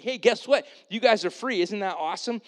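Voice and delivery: high, loud, excited jailer; stoked. Acted. Jailer telling the prisoners they are free, excited and high. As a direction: bubble over with the good news. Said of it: "He's all stoked".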